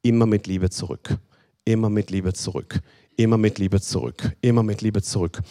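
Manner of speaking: low, soft, repeated refrain; soft repeated mantra. Shown as a demonstration. Preacher repeating the answer to the congregation, low and soft. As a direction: say it gently like a mantra. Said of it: "Und was ist dein Weg?".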